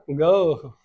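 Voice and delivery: गो as a game announcer says it, gliding like आओ; गो stretched into an ao sound, imitating a game; racing game go. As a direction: call out गो with an ao ending, like a race start. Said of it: "आओ वाला sound निकलेगा".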